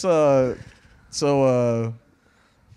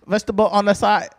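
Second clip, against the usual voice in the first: raised, hammed-up Vietnamese accent; mock Vietnamese accent; 3 semitones higher, 4 dB louder than usual. Comedian pretending to order sandwiches, mock Vietnamese accent. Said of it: "Don't make me do my Vietnamese accent".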